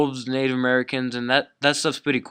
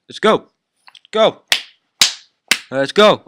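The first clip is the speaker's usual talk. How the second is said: pitched-up, loud hype shout; hype call; 6 semitones higher, 7 dB louder than usual. Real, not acted. Vlogger firing up his audience with a loud hype call. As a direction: pump the viewers up.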